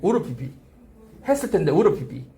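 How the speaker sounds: "우르 피피" said low with would've as a quick ur; reduced would have. Shown as a demonstration. Teacher drilling students on reduced would have. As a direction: model "우르 피피" clipped and fast. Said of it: "이 발음이 나오면".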